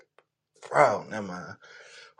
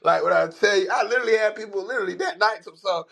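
ordinary talk

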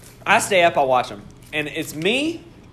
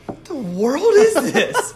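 startled; 9 semitones higher, slower than usual. Retold or imitated. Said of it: "and I'm like".

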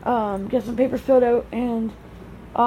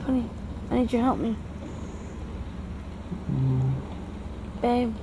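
quiet, coaxing pet names; softly calling a sleeping husband; soft wake-up calls; 8 dB quieter than usual. Real, not acted. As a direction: coax a sleeping husband awake gently. Gently waking sleeping husband.

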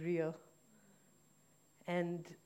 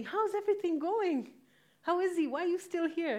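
nosy acquaintances; 10 semitones higher, 5 dB louder than usual. Retold or imitated. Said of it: "they'd ask me".

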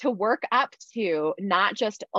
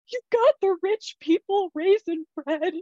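mock tearful wail; 12 semitones higher than usual. Sobbing reply to husband.

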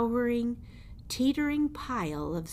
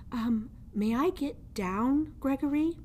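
higher, hesitant little mouse voice; small timid mouse; 7 semitones higher than usual. Acted. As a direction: ask meekly from inside the fist.

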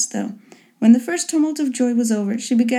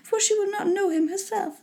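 high, soft, complaining mother voice; high-pitched matronly fussing; fretting mother; 9 semitones higher, 5 dB quieter than usual. A narrator's reading. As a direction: voice a mother vexed by a thwarted plan.

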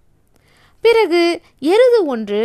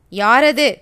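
angry threat; 5 dB louder, much slower than usual. A narrator's reading. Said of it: "குள்ளநரி கோபத்தோட மிரட்டுச்சு".

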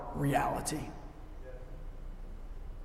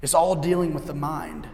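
usual speaking voice

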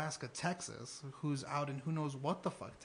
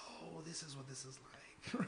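hushed, laughing aside; whispered realization; 7 dB quieter than usual. Retold or imitated. Recalling moving to the sticks, hushed amazed whisper. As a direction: breathe it like a secret discovery.

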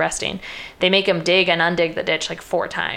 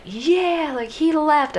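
high-pitched celebrating camp, at normal volume; excited cheer; 10 semitones higher, much slower than usual. Retold or imitated. Prisoners celebrating that he left, excited. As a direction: cheer in a much higher voice. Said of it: "they're all excited".

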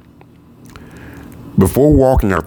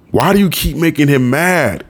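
higher weary mother voice; 9 semitones higher than usual. Acted. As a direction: voice the tired mother.

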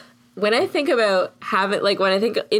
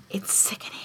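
breathy whisper; 3 semitones lower, 13 dB quieter than usual. Retold or imitated. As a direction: whisper it with relish. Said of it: "I'll be like".

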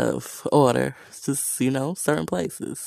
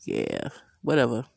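lower, bored brush-off; dismissive; 5 semitones lower, 4 dB quieter than usual. Retold or imitated. The ex brushing off her effort, bored and low. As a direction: toss it away as if you could not care less. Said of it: "they just was like".

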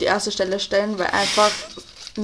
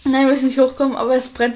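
usual speaking voice

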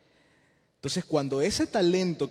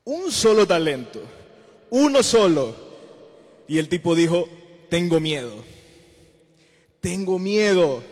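louder preaching on a single talent, then a loud fearful cry of tengo miedo; 11 dB louder than usual. Acted. Preacher to youth group, shouting the servant's fear.

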